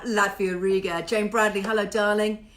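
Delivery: ordinary talk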